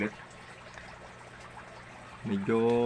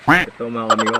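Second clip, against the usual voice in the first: loud; 13 dB louder than usual. Real, not acted. Sudden loud announce.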